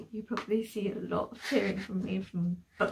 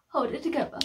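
louder "Hold it together", pushed out; ordering herself to stop crying; firm self-talk; 3 semitones higher, 5 dB louder, faster than usual. Real, not acted.

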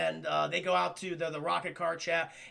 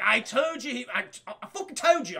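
attempted Scottish accent; 3 semitones higher, 4 dB louder than usual. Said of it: "I can't do a Scotch accent".

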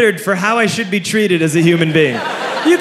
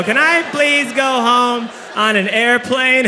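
high, slow mock-whimpering plea; hammed-up helpless begging; pitiful pleading; 6 semitones higher, slower than usual. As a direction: beg like a defeated passenger, drawn out. Passenger pleads at help desk.

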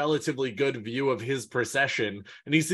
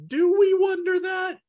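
much higher, louder mock disbelief; sarcastic shout; 5 dB louder than usual. Real, not acted. Co-host challenging the claim, sarcastic shout.

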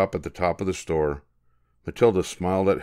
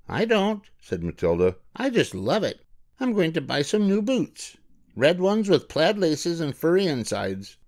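put-on higher, eager child voice; excited little girl; 10 semitones higher than usual. Matilda tells mother about her dream boots, eager girl voice. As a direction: gush about the new boots.

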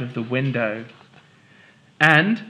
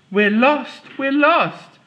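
high-pitched worried wail; anxious cry; 11 semitones higher, 4 dB louder, slower than usual. Acted. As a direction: sound scared and lost. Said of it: "Polly cried".

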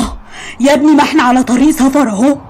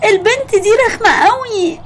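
put-on whiny child complaint; 9 semitones higher than usual. Child complaining to mother in tears.

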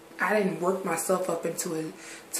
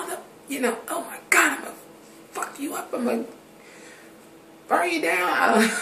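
higher-pitched, imitating her old hysterical fit; mock hysterical rage; 9 semitones higher, 4 dB louder than usual. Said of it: "not went into hysterics".

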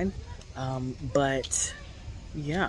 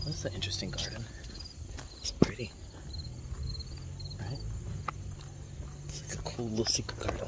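whispering; 3 semitones lower, 9 dB quieter than usual. Real, not acted. Whispering past a secret garden.

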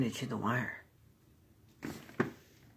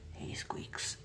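whispering; 8 dB quieter than usual. Real, not acted. Coaxing shy pet quietly.

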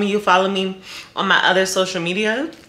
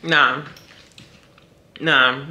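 mouth full; 4 semitones lower than usual. Real, not acted. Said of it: "I'm sorry if I'm chewing so loud".

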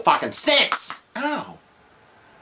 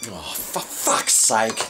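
ordinary talk

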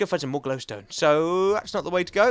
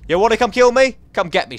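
mock taunt; 5 semitones higher, 9 dB louder than usual. Real, not acted. Taunting the enemy.

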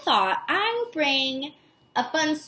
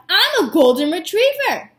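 louder, a quick boastful blurt; 4 semitones higher, 7 dB louder than usual. Acted. Little dog fibbing to new neighbor, blurted loudly. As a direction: blurt it out before thinking. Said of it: "he blurted out".

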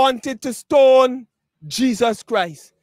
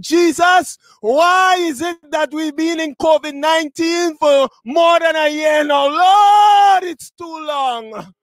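raised, drawn-out cry to heaven; pleading complaint; 5 semitones higher than usual. Acted. Weary believers asking Jesus about covid, pleading cry. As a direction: plead it, peak on the cry, then drop away. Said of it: "We may be wondering".

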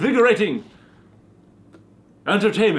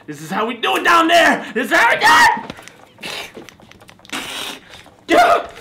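gloating shout; 10 semitones higher, 3 dB louder, faster than usual. Acted. Vampire gloating while carving.

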